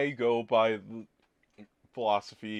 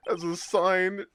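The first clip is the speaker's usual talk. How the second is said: rising mock swoon; 13 semitones higher, 7 dB louder than usual. Mock-romantic accusation rising.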